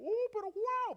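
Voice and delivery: high, loud whoop of wonder; hammed-up astonishment; amazed exclamation. Pedro finding himself standing on water, amazed exclamation.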